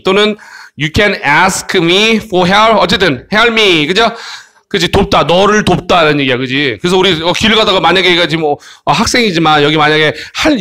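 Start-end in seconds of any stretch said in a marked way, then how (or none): none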